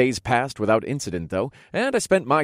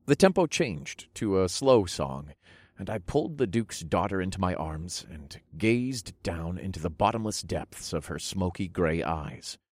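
usual speaking voice

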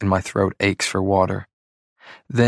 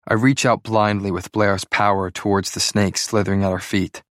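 plain reading voice

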